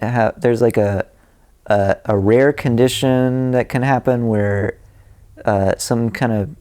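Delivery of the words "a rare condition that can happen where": usual speaking voice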